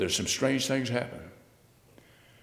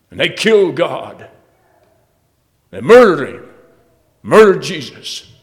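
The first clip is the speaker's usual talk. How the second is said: preaching at full force; 5 semitones higher, 12 dB louder than usual. Acted. Preacher thundering to the congregation about killing God, full force. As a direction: hammer it home like a revival preacher.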